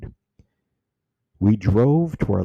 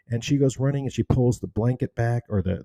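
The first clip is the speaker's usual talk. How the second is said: normal talking voice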